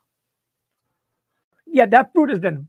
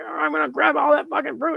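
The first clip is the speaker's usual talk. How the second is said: higher, mocking voice; mock greedy swabber; 7 semitones higher than usual. Mocking careless sellers, greedy mock voice. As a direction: sneer it like a grabby amateur. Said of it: "trying to".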